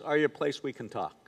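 low, hushed question; discreet doctor. Retold or imitated. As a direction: imitate a doctor softly preparing someone for bad news. Doctor preparing patient for bad news.

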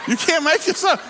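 high, loud laugh through the words; can't hold back the laughter. Real, not acted. Preacher cracking up at his own airplane story, laughing.

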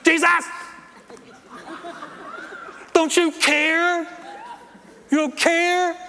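high, loud, drawn-out wail; hammed-up desperate shout to heaven; frustrated cry. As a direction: wail in mock accusation, stretching the words. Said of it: "you hear what a lot of people do".

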